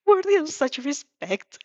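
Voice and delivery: put-on slow, earnest formal boy voice. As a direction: say it with solemn politeness. Formal boy character speaking.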